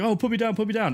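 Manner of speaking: put-on high, quick little-kid plea; mock pleading. Kid over the shoulder asks to be set down, high mock pleading. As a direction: imitate a kid begging, pitched well up.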